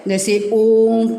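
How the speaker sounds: ओम held for three beats, slow; drawn-out Om. Shown as a demonstration. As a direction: stretch the vowel of ओम long. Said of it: "तीन का अंक लगाया जाता है". (ओम को तीन मात्रा तक खींचकर)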